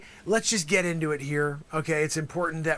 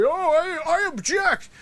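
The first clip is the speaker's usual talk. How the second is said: high, mock whiny I object; mock prissy objection; mocking objector; 11 semitones higher, 4 dB louder, slower than usual. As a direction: squeak it out like a fussy lawyer. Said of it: "they're gonna say".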